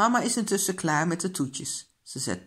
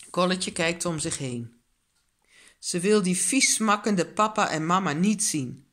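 usual speaking voice

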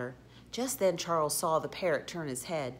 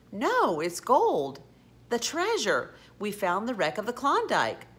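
excited discovery; 5 semitones higher, 5 dB louder than usual. A narrator's reading. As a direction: announce the find with rising wonder. Charles discovers the sunken treasure.

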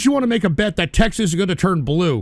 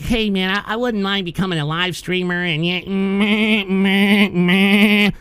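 mocking whiny troll; slower than usual. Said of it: "all of you people that are like".

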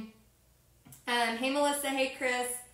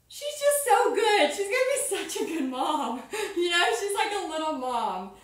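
gushing pride; 6 semitones higher, 4 dB louder than usual. Retold or imitated. About her daughter's little notes, high proud gushing. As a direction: gush over her like a proud mom.

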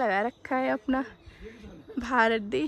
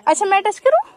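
high, loud excited question; thrilled to try touching it; 6 semitones higher, 11 dB louder, much faster than usual. Real, not acted. Woman excitedly asking whether she may touch the butterfly.

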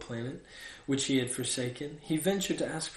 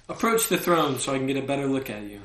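loud, self-important summons; proud king; 6 dB louder, faster than usual. A narrator's reading. King summoning his visitor at last, proud. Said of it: "very proud of being king".

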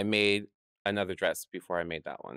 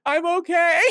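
tearful reply; 11 dB louder than usual. Retold or imitated. Crying in stall, answering boy.

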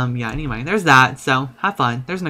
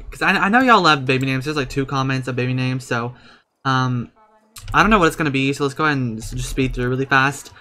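ordinary talk, nothing marked

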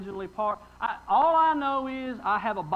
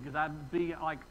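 ordinary delivery

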